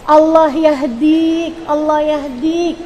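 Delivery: slow, drawn-out invocation in place of scolding; restrained parental prayer. Shown as a demonstration. Angry parent praying for the child instead of cursing, drawn out slowly. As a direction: stretch each word out slowly, holding back anger. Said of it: "cuman ngomongnya kayak gini".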